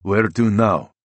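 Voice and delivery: put-on driver in a heavy Russian accent. A narrator's reading. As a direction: ask it in a thick Russian accent. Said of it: "asks with a heavy Russian accent".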